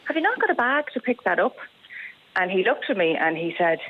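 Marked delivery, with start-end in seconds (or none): 0.1-1.6 put-on higher, gentle polite question to a boy; very nicely. Woman politely asks boy with the dog, nice and high. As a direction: ask kindly, no edge. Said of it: "So I just said to him very nicely".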